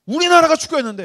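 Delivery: high, loud indignant protest; acting out an outraged soccer fan; indignant young churchgoer. Indignant youth confronting his pastor.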